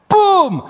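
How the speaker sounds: a high, loud laughing "pum" as the punch lands. Retold or imitated. Preacher retelling the boxing punch, loud laughing pum. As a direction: burst out laughing on the impact.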